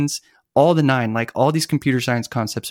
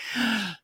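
short sharp gasp of mock alarm; a quick, theatrical intake of breath; 10 semitones higher, 9 dB quieter than usual. Expert reacting to a centering request with a mock gasp.

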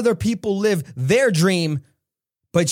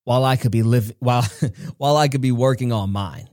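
a hint of a wise older mentor; Les Brown; 6 semitones lower than usual. Mentor answering his phone call, lower and deliberate. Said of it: "He said".

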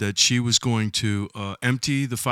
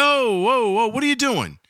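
mock alarm; 9 semitones higher than usual. Protesting to the firemen.

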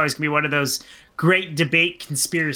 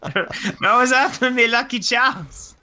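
put-on Irish accent; 4 semitones higher than usual. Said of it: "my British accent".

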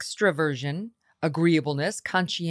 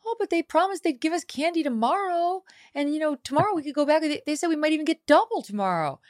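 put-on much higher, bright little boy voice; upbeat kid; 11 semitones higher than usual. Mother voicing her son's cheerful buddy, high and chirpy. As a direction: cheerfully talk up tomorrow's double candy like a kid. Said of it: "his little buddy was like".

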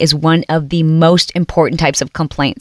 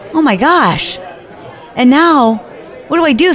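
normal talking voice

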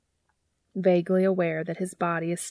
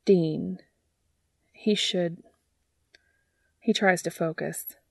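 ordinary delivery, nothing marked

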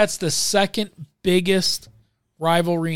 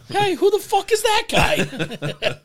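much higher, slow, laughing doll voice; pull string toy; slower than usual. Pretend. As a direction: squeak it out slowly through laughter. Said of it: "Pull the string again".

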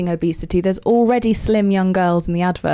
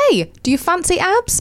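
cheery ad pitch; 10 semitones higher than usual. Retold or imitated. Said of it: "a mild suggestion of".

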